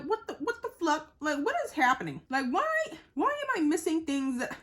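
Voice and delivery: high, loud retold outburst of frustration; upset outburst. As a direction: relive the moment you realized you missed it, loud and high. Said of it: "And I was so upset".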